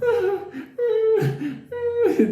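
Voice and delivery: very high mock whimper; high imitated canine whine; dog's balking noise. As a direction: squeal like a reluctant dog. Imitates dog balking at old lady.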